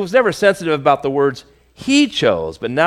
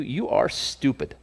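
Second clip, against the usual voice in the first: soft blunt verdict; 4 semitones lower, 10 dB quieter than usual. Real, not acted. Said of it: "I just want to tell you".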